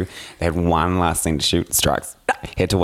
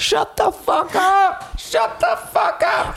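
higher, slow mock shout; mock angry crowd; much slower than usual. Cinema crowd shouting down a talker, slow mock yell. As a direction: drag out the hushing like an angry audience. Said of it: "people were like".

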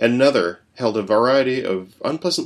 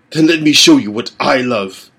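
louder, higher gloating promise; louder sadistic father; cruel father; 4 semitones higher, 7 dB louder than usual. A narrator's reading. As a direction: savour the cruelty. Father before killing dog.

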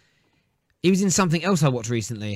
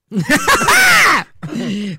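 sudden loud high burst of laughter; real shout of laughter; 10 dB louder than usual. Real, not acted. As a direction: crack up at the joke. Bursts out at the drinking joke.

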